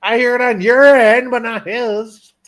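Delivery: real protest, high-pitched and stretched at the end. Real, not acted. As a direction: protest loudly over the echo. Arguing over echo on the call.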